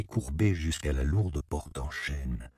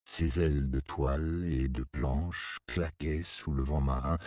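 ordinary delivery, nothing marked

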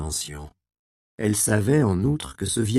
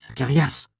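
urgent call; 6 semitones higher, 3 dB louder than usual. Acted. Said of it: "répéta-t-elle en secouant l'homme".

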